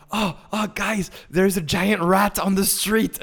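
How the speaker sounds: high, slow, alarmed call about a giant rat. Retold or imitated. [name] warning friends in the car, in a high, slow, alarmed voice. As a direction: shout in surprise, slowly and high. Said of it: "I'm like".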